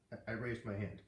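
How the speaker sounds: plain reading voice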